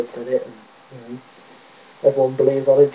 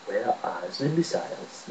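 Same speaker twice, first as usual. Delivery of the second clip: low, soft attempt at Russian; bad Russian accent; 4 semitones lower, 14 dB quieter than usual. Repeating the missiles line, soft bad Russian accent. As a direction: quietly try a poor Russian accent. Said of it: "I sounded nothing like a Russian".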